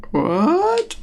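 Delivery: high, slow puzzled creature voice; a baffled beast asking questions; confused dinosaur voice. Acted. GM voices triceratops again.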